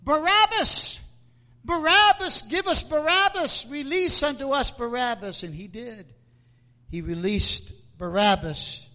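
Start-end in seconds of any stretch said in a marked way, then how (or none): 0.0-5.4 higher, mock crowd shout; crowd crying out. Crowd demanding Barabbas from Pilate, mock shout. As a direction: call out like an angry mob. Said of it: "And the people cried out".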